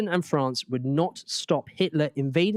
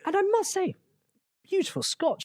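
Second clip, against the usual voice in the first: posh Chamberlain voice. Acted. Roleplaying Chamberlain to Hitler.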